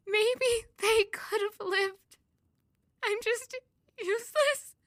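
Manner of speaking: high, loud tearful sobbing words; breaking down crying. Acted. Grieving daughter breaks down to her friend, crying. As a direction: voice cracking as she breaks into tears.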